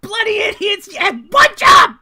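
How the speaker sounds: very high-pitched, loud character shriek; screaming jester. Acted. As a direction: shriek furiously at the guards as the jester. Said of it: "he starts screaming at the guards".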